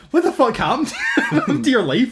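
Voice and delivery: high, slow cry of disbelief breaking into laughter; laughing disbelief. Real, not acted. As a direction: laugh it out in outrage at her fate. Incredulous about actress's fate.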